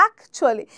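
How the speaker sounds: actually clipped to "akchli", middle swallowed, low and soft; actually said native-style, "akchli", dropped quiet; correct pronunciation. Shown as a demonstration. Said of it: "চুয়ালিটা আমরা কেটে বলছি".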